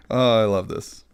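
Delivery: ordinary talk, nothing marked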